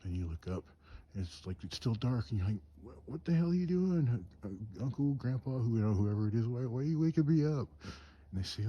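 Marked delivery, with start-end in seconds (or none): 2.8-7.7 high, rising indignant complaint; groggy protest. Retold or imitated. Sleeper to uncle who woke them in the dark, indignant protest. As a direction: imitate a bewildered, put-out kid. Child woken in cold dark.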